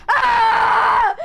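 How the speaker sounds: loud, high rage shout; angry yell. Retold or imitated. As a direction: scream it as you strike. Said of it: "And then he goes".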